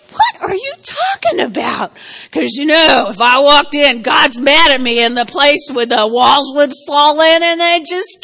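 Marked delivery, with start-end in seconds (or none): 0.1-1.9 incredulous. Retold or imitated. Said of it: "I'm like".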